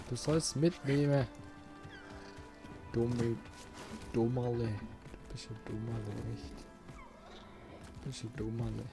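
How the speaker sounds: ordinary delivery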